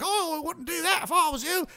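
high, posh-ish British bird voice; British bird accent. Pretend. Birds overhead warning the fool, in a British accent. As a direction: warn them in a prim British accent. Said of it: "Birds have a British accent".

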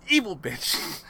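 normal talking voice

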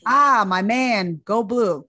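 put-on attending hailing the Michigan kid; hearty greeting. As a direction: greet him like a fellow fan. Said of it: "and he says".

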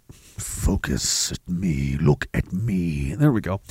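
very slow, low and soft; coaxing the camera. Real, not acted. Talking to a camera that won't focus, low coaxing. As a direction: draw it out slowly, low and quiet.

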